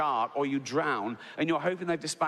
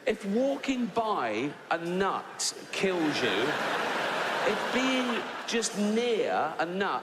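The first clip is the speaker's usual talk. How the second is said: higher, slow indignant rant; comic outrage, higher and slower; fuming rant; 6 semitones higher, slower than usual. Real, not acted. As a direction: rail at the allergy, outraged. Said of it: "I was fuming".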